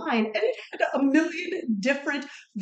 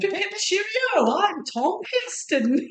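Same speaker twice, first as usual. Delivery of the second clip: put-on British accent; 9 semitones higher than usual. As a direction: ham up a posh British accent. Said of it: "British accent".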